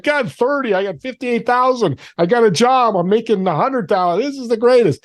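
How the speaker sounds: high-pitched, giddy re-enactment of a windfall; elated. Retold or imitated. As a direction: relive it like you just won the lottery. Re-enacts thrill at finding the money.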